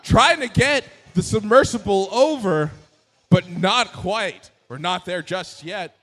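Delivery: excited live commentary; play-by-play commentary. Acted. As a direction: call the match as it happens.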